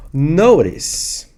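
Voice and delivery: "Notice" with American tapped T, like "no-ris"; American accent. Shown as a demonstration. Teacher pointing out own pronunciation, American-accented "Notice". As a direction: say "Notice" American-style, T as a quick flap. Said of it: "sotaque mais americano".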